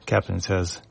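ordinary delivery

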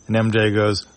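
usual speaking voice

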